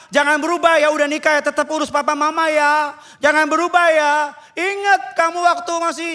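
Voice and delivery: high-pitched, insistent preaching; pleading exhortation. Real, not acted. Pastor reminding newlyweds to care for parents, pleading.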